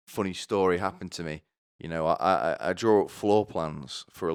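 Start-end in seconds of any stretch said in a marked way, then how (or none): none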